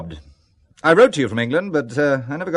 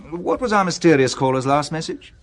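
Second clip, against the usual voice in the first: ordinary talk, nothing marked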